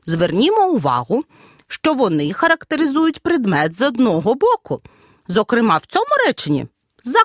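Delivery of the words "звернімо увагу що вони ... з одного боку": usual speaking voice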